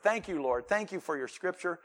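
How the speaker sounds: soft, low, earnest prayer voice. Real, not acted. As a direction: pray quietly and reverently. Pray with me.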